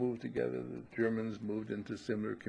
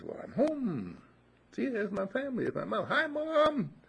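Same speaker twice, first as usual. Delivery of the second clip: high voice building to a louder call of Mom; returning frog calling to his family across the pond, higher and louder; eager frog calling out; 13 semitones higher, 4 dB louder than usual. Acted. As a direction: wave and shout hi to your mother. Frog calls to his mother.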